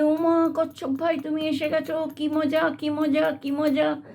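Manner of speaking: high, gleeful cheering; excited monkey. Acted.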